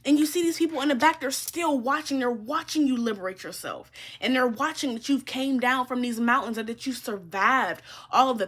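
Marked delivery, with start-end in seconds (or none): none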